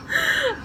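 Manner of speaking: squeaky exasperated sigh; embarrassed sigh at the memory; high overwhelmed sigh. Real, not acted. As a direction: let out a high-pitched sigh.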